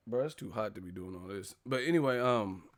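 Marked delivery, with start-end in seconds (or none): none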